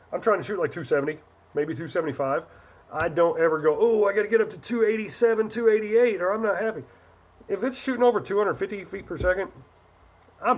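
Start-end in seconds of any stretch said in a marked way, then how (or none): 3.8-6.8 higher-pitched mock fretting; put-on worry, pitched up; mock fussing. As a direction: imitate a fussy player worrying. Mocks players fussing over velocity.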